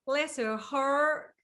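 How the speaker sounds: slow drawled "Bless her heart", rising on "heart"; slow Southern drawl. Shown as a demonstration. Host copies the guest's Southern phrase, slow drawl. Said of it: "That's better".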